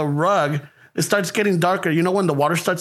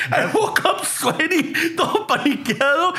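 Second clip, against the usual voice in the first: laughing; 6 semitones higher than usual. Real, not acted. Still laughing, retelling nightmare.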